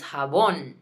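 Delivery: "jabón" as "ha-BON", second syllable held; stress on last syllable. Shown as a demonstration. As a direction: lean on the end of "jabón". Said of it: "we put the emphasis on it".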